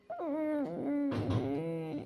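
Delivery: long, soft whimpering sob; a drawn-out, hushed acted cry; acted sobbing. Upset sister breaks down before her friend, soft sobbing. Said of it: "ಯಾಕೆ ಅಳ್ತಿ ಅಕ್ಕ".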